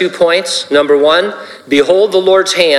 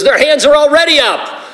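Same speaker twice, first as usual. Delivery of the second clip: Sundance voice; 13 semitones higher than usual. Retold or imitated. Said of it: "Sundance goes".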